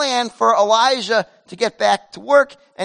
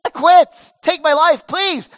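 high, loud cry of giving up; despairing Elijah; 8 semitones higher, 8 dB louder, slower than usual. Acted. Elijah crying out to God that he quits, in a high loud wail. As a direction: wail it like a prophet who wants to quit. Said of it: "That's what Elijah is saying".

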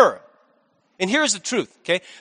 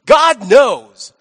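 loud acted assertion; emphatic; 8 dB louder than usual. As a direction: hit both words hard, as a bold claim. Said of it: "he's emphatic".

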